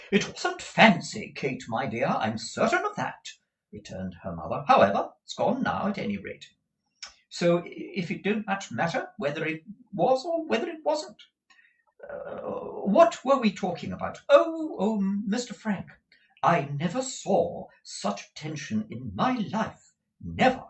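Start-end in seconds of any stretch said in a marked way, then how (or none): 0.1-3.3 high, swooping motherly insistence; emphatic high-voiced mother; insistent Mrs. Nickleby. 4.7-20.6 high, flighty chattering mother; swooping high voice of a scatty lady; rambling Mrs. Nickleby. Acted.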